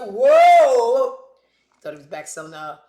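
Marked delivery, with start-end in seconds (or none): 0.0-1.2 loud startled "Whoa" in another voice; surprised whoa. Retold or imitated. Skip catching her injecting in the bathroom, loud startled whoa. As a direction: blurt it out as a shocked man walking in. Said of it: "He's like".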